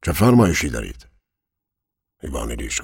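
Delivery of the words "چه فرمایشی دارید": eager to serve, higher and louder; obliging. A narrator's reading. Servant rushing up for his master's orders, obliging. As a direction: ask like a keen servant. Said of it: "از سر خوشخدمتی پیش دوید و پرسید".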